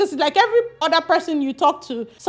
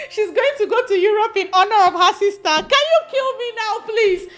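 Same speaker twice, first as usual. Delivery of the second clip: higher, incredulous rant; exasperated disbelief; 7 semitones higher than usual. Real, not acted. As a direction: plead to be put out of misery. Outraged at absurd plot.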